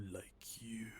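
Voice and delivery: breathy ghost whisper; eerie whispered echo; ghostly whisper. Acted.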